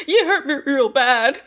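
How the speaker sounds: mock whimpering; hammed-up hurt; Jack. Locked-in husband guilt-trips his wife, mock whimpering. Imitating Jack's feigned hurt.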